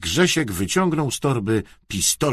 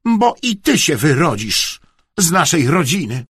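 louder, raised scolding; stern warning; 6 semitones higher, 6 dB louder than usual. A narrator's reading. Aunt warning her nephew, sternly. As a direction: threaten with emphasis. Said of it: "pogroziła Bartusiowi palcem".

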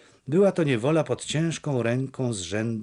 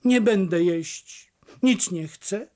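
petulant and slow, pitched higher; capricious; 7 semitones higher than usual. A narrator's reading. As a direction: sulk like a spoiled daughter. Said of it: "rzekła kapryśnie".

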